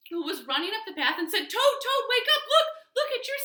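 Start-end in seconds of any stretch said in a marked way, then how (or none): 1.5-3.4 high, loud, urgent calling; excited wake-up call. A narrator's reading. Frog waking Toad to see sprouts, excited wake-up call.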